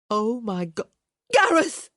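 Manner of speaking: high, loud shriek of a name. A narrator's reading. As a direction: scream for him in wild joy. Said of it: "she shrieked".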